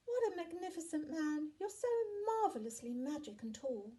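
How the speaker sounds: put-on high, loud child voice; high-pitched flattering children; admiring children. A narrator's reading. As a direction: gush up at the giant like an excited child.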